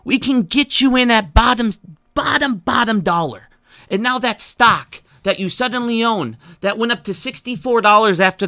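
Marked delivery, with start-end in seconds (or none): 0.0-3.5 pitching as Adam Aaron. Pretend. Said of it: "I'm Adam Aaron and I show them this".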